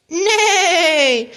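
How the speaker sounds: high, falling whinny; long neigh sliding down from high; horse's neigh. A narrator's reading. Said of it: "The horse".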